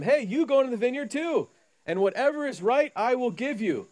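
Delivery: hearty vineyard owner hiring; master calling out. Acted. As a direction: call the idle men over cheerfully. Master voiced hiring idle workers.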